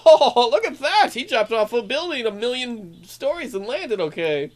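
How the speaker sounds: high, loud, hammed-up gushing; mock amazement. Host mocking viewers awed by a silly stunt, mock amazement. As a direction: laugh through an exaggerated marvel. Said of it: "Not over the top, we're like".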